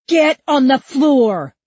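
high, loud, drawn-out order; shouted command. A narrator's reading. Cop to Huck, shouted command. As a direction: stretch each word in a big yell.

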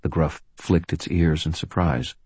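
usual speaking voice

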